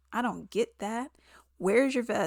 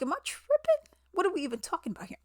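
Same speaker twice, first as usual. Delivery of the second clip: incredulous outburst; 8 semitones higher, much faster than usual. Retold or imitated. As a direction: shoot way up in mock disbelief, then rattle off the rest fast. Incredulous reaction to the show.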